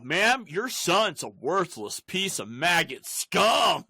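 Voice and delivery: slow, emphatic mock-official insult; imitated cop lecture. Cop calls his mom about her son, slow mock scolding.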